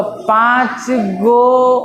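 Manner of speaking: पाँच गो dragged out very slowly; mock shop request, drawn out; wrong way, slow. Shown as a demonstration. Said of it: "नहीं, नहीं, पाँच गो नहीं बोलते".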